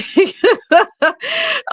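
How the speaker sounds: very high laughing burst; exasperated laugh. Real, not acted. Laughing at own anger.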